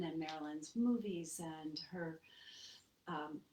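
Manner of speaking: ordinary talk, nothing marked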